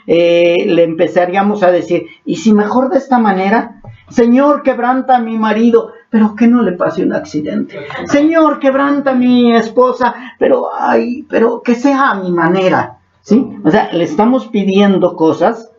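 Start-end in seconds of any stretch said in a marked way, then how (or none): 2.3-13.0 high, pleading, self-serving prayer voice; mock selfish prayer. Pastor mimicking a believer bargaining in prayer, high and pleading. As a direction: beg God to do it your own way.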